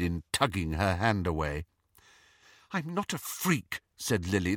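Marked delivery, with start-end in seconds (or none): 2.7-3.8 higher, softer, hurt; a wounded girl answering back; hurt young girl. A narrator's reading. Lily hurt by Petunia's insult, high and soft.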